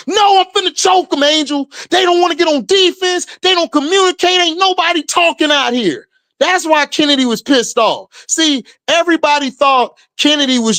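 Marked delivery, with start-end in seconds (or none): 0.1-6.1 higher, fast heated rant; a teammate fuming, ready to choke someone; mock angry rant. Kennedy tells Angel she is furious, fast and high. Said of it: "Angel was like".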